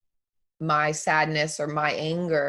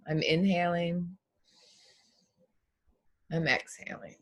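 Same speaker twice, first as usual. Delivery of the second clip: soft, unhurried meditation voice with long pauses; guided meditation; 3 dB quieter than usual. Acted. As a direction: guide them gently through breath and body. Leads a breathing meditation.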